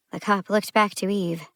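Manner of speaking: ordinary delivery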